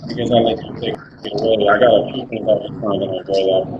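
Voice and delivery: muffled, distant mic sound. Real, not acted. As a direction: talk as if behind a wall. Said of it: "like, muffled".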